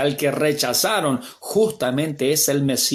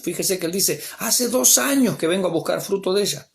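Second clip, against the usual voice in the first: fiery preaching; 8 semitones higher than usual. Acted. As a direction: preach it with rising fervor. Preaches at full force.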